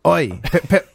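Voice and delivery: a hint of a British accent; light, hammy British accent. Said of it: "There's my British accent".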